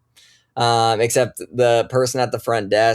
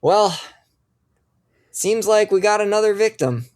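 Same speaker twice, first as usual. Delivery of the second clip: grim sheriff; 9 semitones higher than usual. Acted.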